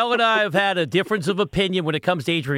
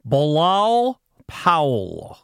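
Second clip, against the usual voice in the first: slow, drawn-out announcer call on Bilal Powell; close-mic announcer voice; much slower than usual. Pretend. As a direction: stretch the name out like a ring announcer. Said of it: "I really have to say it closely to the microphone".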